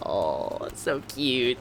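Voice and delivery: ordinary talk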